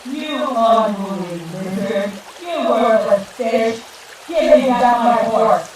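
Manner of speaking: building to a high, loud shout; Monkey shouting curses into the water; furious shout. Acted. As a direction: hurl the insults down at the river dragon. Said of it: "He shouted down into the water".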